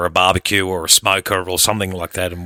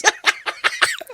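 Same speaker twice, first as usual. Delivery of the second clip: laughing; 3 dB quieter than usual. Real, not acted. Laughing at own joke.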